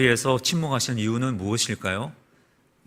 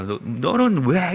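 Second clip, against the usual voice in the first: drawn-out, lightly acted reproach; mock scolding; 2 semitones higher, much slower than usual. Someone correcting their child starts with blame, mock scolding. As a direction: imitate someone scolding with a stretched-out why. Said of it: "막 책망부터 합니다".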